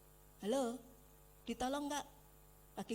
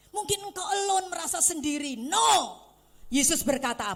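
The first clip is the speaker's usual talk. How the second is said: much louder, higher shout; preaching shout; 5 semitones higher, 12 dB louder than usual. Real, not acted. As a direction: call out to the whole hall. Shouted challenge to the congregation.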